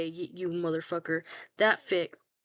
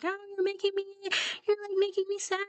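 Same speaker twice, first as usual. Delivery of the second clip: much higher, whiny mock sob; mock crying whine; 14 semitones higher, faster than usual. Friend crying at the writer over the sad fic, hammed-up whiny voice. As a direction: whimper it like a hammed-up crybaby. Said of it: "was crying and yelling at me".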